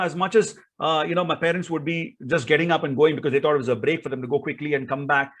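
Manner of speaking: usual speaking voice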